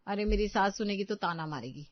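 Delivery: ordinary delivery, nothing marked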